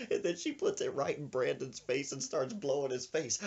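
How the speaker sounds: ordinary talk, nothing marked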